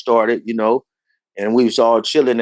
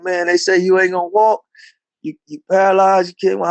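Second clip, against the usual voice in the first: upset friend; 7 semitones higher than usual. Retold or imitated. Crying friend on phone.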